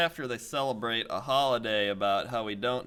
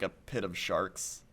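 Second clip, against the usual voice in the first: ordinary talk, nothing marked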